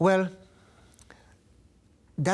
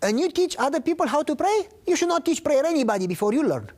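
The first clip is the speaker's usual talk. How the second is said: much higher, quick rebuking voice; Greg scolding; 11 semitones higher than usual. Retold or imitated. Friend Greg rebuking the prayer teacher, higher and faster. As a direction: scold him fast like a blunt friend. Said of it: "He said".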